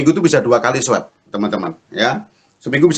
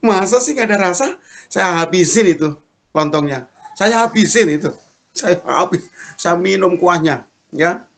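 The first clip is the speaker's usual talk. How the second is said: higher-pitched mock-indignant retort; incredulous protest; 8 semitones higher than usual. Husband disputes wife's complaint of tasteless food, incredulous protest. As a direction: protest with raised pitch, insisting on it.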